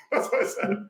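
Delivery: said through a laugh, pitch up high; chuckling at his own joke. Real, not acted. Laughing at own cheeky advice.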